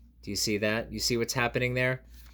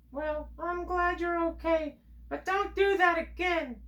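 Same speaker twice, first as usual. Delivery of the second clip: much higher, slow scolding friend; high voiced friend. Friend to the reader after the fall, high scolding voice. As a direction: gently scold in a high character voice.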